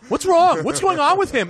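high, loud alarmed daughter; panicked daughter. Retold or imitated. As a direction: shout it high as the worried daughter. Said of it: "she goes".

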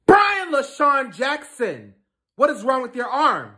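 high, loud, drawn-out name; stern full-name demand. Acted. Mother demanding ignoring son explain his arm, stern and loud. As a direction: raise your voice and stretch the name.

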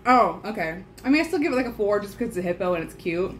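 food in mouth; mid-bite chatter; talking while chewing. Real, not acted. Seen 2: Left speaker holds biscuit pieces, jaw chewing between remarks throughout.

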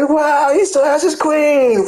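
high, drawn-out whine of disbelief; mock dismay. Retelling shock at a rival's character, mock dismayed. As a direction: stretch it high, hammed-up outrage.

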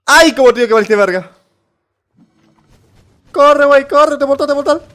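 high, loud frantic cheering; excited yelling. Real, not acted. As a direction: yell at the screen to run. Cheering the character on while he runs. (gritos eufóricos y agudos)

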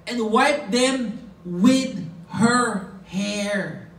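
"And wiped them with her hair" slow, word by word; weight each word of "And wiped them with her hair", stretching "her hair"; emphatic. Shown as a demonstration. Preacher showing where the verse's emphasis lies, "wiped them with her hair" slow and deliberate. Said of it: "That was the emphasis".

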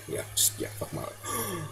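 slow, defeated whisper; whispered despair. Real, not acted. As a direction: breathe it out, beaten. Despair after dying.

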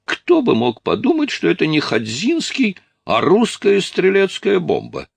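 very high, incredulous character voice; hammed-up high-voiced amazement; astonished outrage. Strus is astonished at being fooled.

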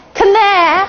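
"k、n、a" with an audible k, pitched high; K sounded before N. Shown as a demonstration. Teacher quizzing class on silent k, k pronounced. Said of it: "是念k、n、a".

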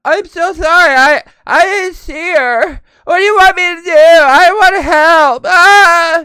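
hammed-up high, loud wail; mock wailing apology. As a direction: drag it out into a mocking howl. Mocks the driver's apology.

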